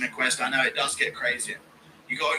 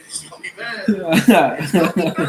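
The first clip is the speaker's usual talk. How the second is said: Ian impression; 5 semitones higher, 8 dB louder than usual. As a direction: moan it, then drag it out louder. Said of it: "impression of Ian saying".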